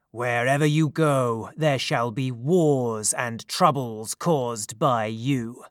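slow, low pronouncement of doom; thunderous curse. A narrator's reading. Furious god cursing his banished daughter, in a thunderous voice. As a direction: lay down a curse in a god's measured thunder. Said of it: "he shouted in a voice of thunder".

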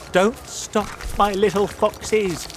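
a father cheering his cubs; encouraging Mister Fox. A narrator's reading. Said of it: "said Mister Fox".

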